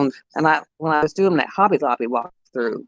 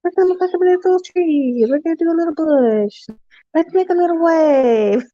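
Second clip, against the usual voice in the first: Bob Ross; 13 semitones higher than usual. Retold or imitated. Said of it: "he was so soft-spoken".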